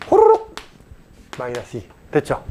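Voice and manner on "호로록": very high, loud little zip sound; playful slurp effect. Shown as a demonstration. Sound effect while moving a term.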